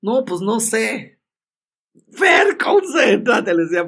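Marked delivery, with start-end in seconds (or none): 0.0-1.1 brother's reply. Retold or imitated. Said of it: "me dijo". 2.1-3.5 much higher, slow, loud call of Fer; desperate, drawn-out shouted plea; frantic shout. Retold or imitated. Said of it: "así todo nervioso".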